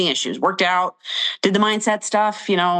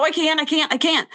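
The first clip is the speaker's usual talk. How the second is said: panicked repeating; 10 semitones higher than usual. Retold or imitated. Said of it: "I go".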